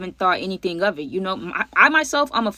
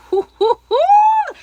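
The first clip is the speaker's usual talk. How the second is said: big shrieking burst of laughter; loud high laugh; 9 dB louder than usual. Real, not acted. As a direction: cackle out loud, delighted. Loud laugh after the story.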